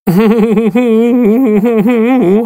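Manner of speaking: loud high wailing sob; loud high wail of a shorn sheep. A narrator's reading. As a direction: burst into a bleating wail. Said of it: "heult das Schaf los".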